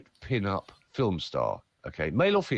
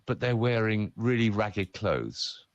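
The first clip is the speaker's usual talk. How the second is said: ordinary talk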